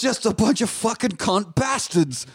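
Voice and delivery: hammed-up British Thor swearing grandly; British Thor impression. Friends joking about Thor, slow hammed-up British accent. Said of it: "super British in our impression".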